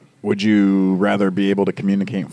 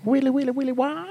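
high silly voice; 5 dB quieter than usual. Acted. Silly noises.

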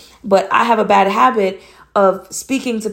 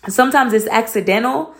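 normal talking voice

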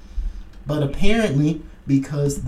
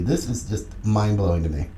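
usual speaking voice

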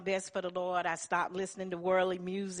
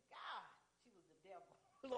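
much softer, whispered comment; hushed aside; 21 dB quieter than usual. Retold or imitated. Laura whispering to Denise about the rude caller, hushed. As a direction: lean in and say it under your breath.

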